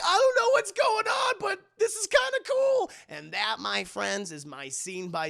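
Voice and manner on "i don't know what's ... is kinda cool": high loud excited yell; thrilled shout. Retold or imitated. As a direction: shout it over the wind. Hannah thrilled on the moped.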